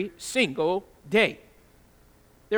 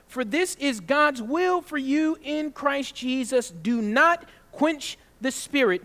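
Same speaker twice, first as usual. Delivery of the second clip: slow, raised, solemn scripture reading; 5 semitones higher, 5 dB louder, slower than usual. Acted. Pastor reading scripture to his congregation, slow and raised. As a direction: read each word out like holy writ. Reads scripture aloud slowly.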